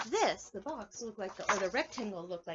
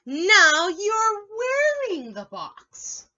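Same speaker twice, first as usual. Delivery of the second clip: high, loud and slow; loud, slow storybook line; 10 semitones higher, 14 dB louder, much slower than usual. Acted. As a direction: call out slowly to the rabbit. Reading question aloud.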